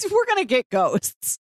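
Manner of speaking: ordinary talk, nothing marked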